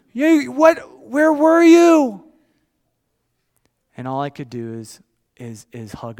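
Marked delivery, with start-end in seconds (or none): 0.1-2.2 very high, loud, drawn-out bawl; hammed-up child's wail, stretching the words; mock weeping. Abandoned little boy demands answers from dad, imitated loud wail. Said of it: "He's weeping".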